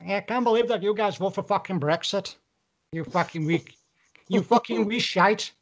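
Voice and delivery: lightly put-on Irish accent. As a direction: grumble in a playful Irish accent. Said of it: "Fucking Irish".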